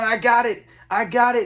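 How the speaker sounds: half-shouted mock alarm; mild mock panicked scream. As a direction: imitate someone panicking, without full volume. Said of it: "he starts screaming".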